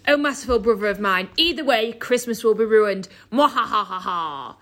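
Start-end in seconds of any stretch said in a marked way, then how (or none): 3.3-4.6 deep slow evil laugh; gloating villain laughter; evil villain cackle. Acted.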